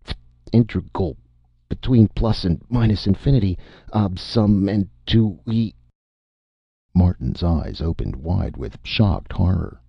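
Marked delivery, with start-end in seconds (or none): 0.0-5.7 robot tipsy on voltage, slurring math; thick drunken voice. Acted. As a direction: mumble it like a drunk. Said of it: "went on rather thickly".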